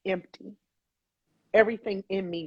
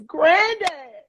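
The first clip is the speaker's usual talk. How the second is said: high, loud retold call; imitated raised-voice call to someone who can't hear; louder call; 10 semitones higher, 8 dB louder than usual. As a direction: call out much louder, pitch jumping up. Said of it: "call him louder".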